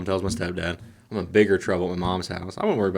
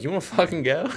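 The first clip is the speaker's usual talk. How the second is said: cocky challenge; 9 semitones higher than usual. Retold or imitated. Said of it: "I was like".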